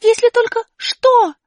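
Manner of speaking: высоко и громко, сквозь плач, медленно; злость вместо страха; злой окрик. Acted. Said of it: "вместо страха вдруг напала злость". (злой высокий выкрик Али)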